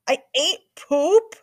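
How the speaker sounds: high, slow disbelieving cry; stunned boy's outburst; shocked question. A narrator's reading. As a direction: blurt it out in horror. Boys shocked, shouting question.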